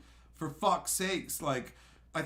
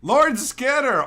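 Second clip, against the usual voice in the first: card announcement; 9 semitones higher, 12 dB louder, slower than usual. Real, not acted.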